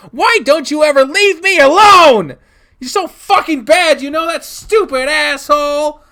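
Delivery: put-on high, loud, screaming child's rage; enraged. Child cutting dad off, enraged screaming. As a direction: scream over him in fury. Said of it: "I've never been this angry before either".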